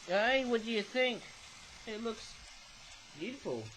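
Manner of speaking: ordinary talk, nothing marked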